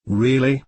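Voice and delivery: "really" weighted and drawn out; stress on really. A narrator's reading. Don Juan pressing the apprentice about fear, stressing "really". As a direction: lean hard on "really" as a challenge. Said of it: "He stressed the word".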